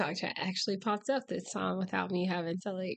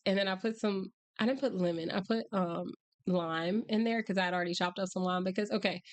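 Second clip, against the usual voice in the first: usual speaking voice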